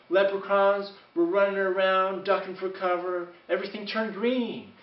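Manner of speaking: ordinary delivery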